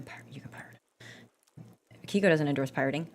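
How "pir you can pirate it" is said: hushed conspiratorial whisper. Acted. To viewers, a sneaky whispered tip about pirating. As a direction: lean in and whisper it like a secret.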